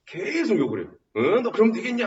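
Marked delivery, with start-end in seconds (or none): 1.2-2.1 high-pitched mock reprimand; a hint of a tiger demanding an answer; scolding tiger. Tiger rebukes the disgraced scholar, scolding. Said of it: "하면서 꾸짖는 거죠".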